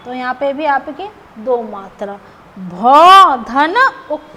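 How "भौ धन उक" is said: slow syllable breakdown. Shown as a demonstration. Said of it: "teacher breaks word into parts".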